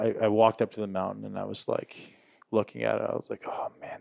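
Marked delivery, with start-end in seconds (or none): 3.5-4.0 soft whispered groan of dread. Retold or imitated. Facing the mountain, whispered dread. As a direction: breathe it out, dreading the climb.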